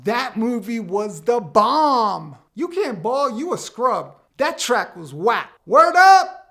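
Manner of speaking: loud, hammed-up street slang; punchy, emphatic old-school slang showing off; eighties slang. Shown as a demonstration. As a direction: belt out dated catchphrases with swagger. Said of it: "I only know the slang from the eighties".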